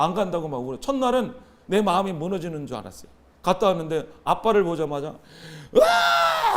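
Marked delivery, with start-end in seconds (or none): 5.7-6.5 high, drawn-out bawling cry; imitated "waaah", pitched way up; loud imitated wail. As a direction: burst into a child's wail, held long. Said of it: "통곡을 하는 거예요".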